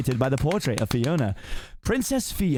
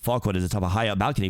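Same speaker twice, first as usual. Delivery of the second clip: ordinary delivery, nothing marked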